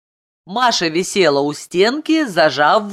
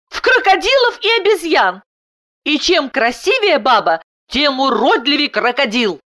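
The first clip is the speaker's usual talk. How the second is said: high-pitched indignant wife; spiteful, angry; 11 semitones higher, 3 dB louder than usual. A narrator's reading. Wife tells husband what their daughter did, angrily. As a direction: complain crossly in a high, raised voice. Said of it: "Жена зло прошипела".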